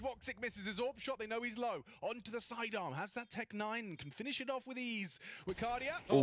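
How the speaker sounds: high, rising live call; excited play-by-play. Real, not acted. Caster calling the clutch.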